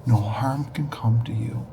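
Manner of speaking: breathy hushed whisper, low and soft; gentle whisper from God to John. Retold or imitated. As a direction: breathe it like a voice from beyond. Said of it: "all I heard him say was".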